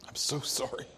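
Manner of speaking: breathless whisper, barely voiced; whispered apology. Grieving father at the cross whispering his apology. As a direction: whisper it as if to yourself.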